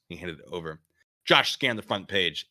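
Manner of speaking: normal talking voice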